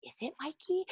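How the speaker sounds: suddenly unsure, squeaky rising question; retold self-doubt. Host replays doubting own correction to mom, doubtful. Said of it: "And I was like".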